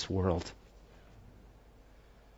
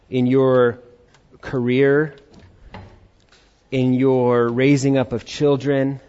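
slow, louder earnest prayer voice; solemn prayer; 2 semitones higher, 5 dB louder, slower than usual. Acted. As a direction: pray it out slowly over the music. Prays over worship music.

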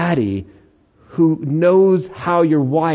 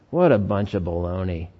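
lower, softer dismissive scoff; scoffing skeptic; 8 semitones lower, 5 dB quieter than usual. Retold or imitated. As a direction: brush it off with contempt. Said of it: "the other person's like".

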